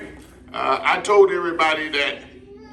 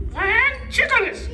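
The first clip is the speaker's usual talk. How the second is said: squawk, then slow, very high parrot voice; mispronouncing parrot. Acted. Parrot puppet attempting the ventriloquist's word, squawky high garble. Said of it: "It's not ventriloquist".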